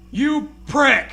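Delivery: usual speaking voice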